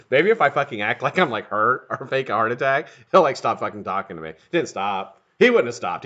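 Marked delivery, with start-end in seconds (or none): none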